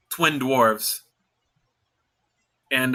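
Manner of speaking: usual speaking voice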